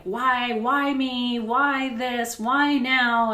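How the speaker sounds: childish complaining, played for laughs; mock whiny outburst. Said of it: "and was like".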